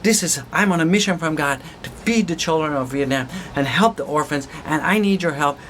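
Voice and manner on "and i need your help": usual speaking voice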